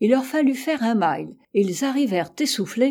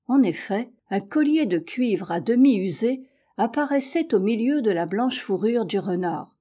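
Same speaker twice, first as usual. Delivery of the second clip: ordinary delivery, nothing marked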